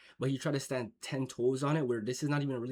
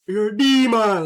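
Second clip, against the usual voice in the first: loud, high jab at a friend; joking call-out; 8 semitones higher, 13 dB louder, slower than usual. Retold or imitated. Calling a friend a demon as a loud joke. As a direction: bark it out as a joking tease. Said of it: "more of an, a joking term".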